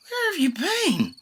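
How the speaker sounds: high slow emotional mother; relieved and scolding at once; mother greeting her lost child; mother crying out. A narrator's reading. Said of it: "cries Mother".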